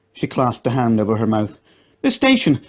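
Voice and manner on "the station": much higher alarmed shout. A narrator's reading. Companion realizes the station hit, higher shout. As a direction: alarm at the blast. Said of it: "shouted her companion".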